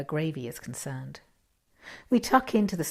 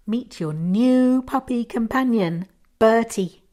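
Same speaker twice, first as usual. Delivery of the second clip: higher, louder announcement; proud reveal; 5 semitones higher, 7 dB louder than usual. Acted. Husband presents the puppy to his wife, proud and loud. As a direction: present the surprise with a flourish.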